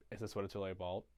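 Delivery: low, soft Grinch mutter; low mock brooding. Grinch confronting the Whos, low quiet acted voice. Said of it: "and he goes".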